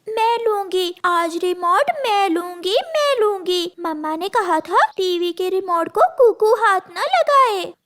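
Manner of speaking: put-on high, insistent little girl; girl claiming the remote. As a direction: squabble over the remote like a small sister. Narrator voices Gudiya.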